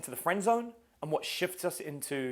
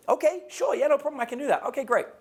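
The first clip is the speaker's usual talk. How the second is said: acted eager guy; 11 semitones higher, 4 dB louder than usual. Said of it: "So I meet a girl".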